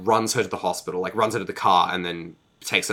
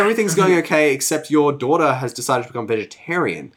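much higher, louder sing-song tattletale voice; sweetly snitch on her to her father; fake cheerful husband; 7 semitones higher, 5 dB louder than usual. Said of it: "He's like, he's like".

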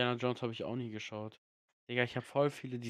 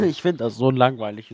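loud laughing complaint; 15 dB louder than usual. Real, not acted. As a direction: laugh it out loudly. Laughing, much louder.

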